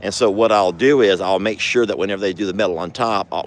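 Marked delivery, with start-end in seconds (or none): none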